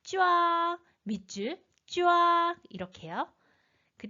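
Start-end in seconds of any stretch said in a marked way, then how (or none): 0.0-0.8 쫙 held long on one high, level pitch; first tone. Shown as a demonstration. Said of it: "일성으로 읽어주세요". 1.9-2.6 쫙 held long on one high, level pitch; first tone. Shown as a demonstration. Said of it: "일성으로 읽어주세요".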